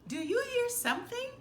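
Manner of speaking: high, fast little Piglet voice; quick small squeaky worry. Acted. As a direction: Piglet asking his friend, high and quick. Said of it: "he said".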